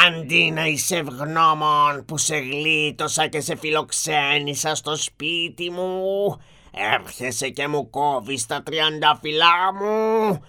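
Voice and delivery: slow, menacing beast voice rising to a loud threat; frightening monster. Acted. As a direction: draw it out like a beast passing sentence. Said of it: "είπε το τέρας με φωνή τρομακτική".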